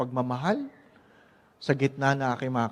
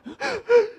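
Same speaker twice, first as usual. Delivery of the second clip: gasp; 5 dB louder than usual. Retold or imitated. Acting out sobbing breath.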